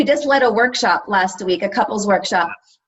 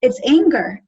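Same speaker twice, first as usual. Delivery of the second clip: usual speaking voice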